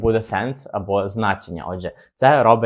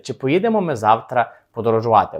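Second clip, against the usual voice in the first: usual speaking voice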